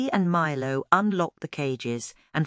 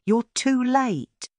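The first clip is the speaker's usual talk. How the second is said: slow, higher sly snake taunt; gloating snake villain; 4 semitones higher, slower than usual. A narrator's reading. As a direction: savor each word like a smug snake. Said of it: "he hissed".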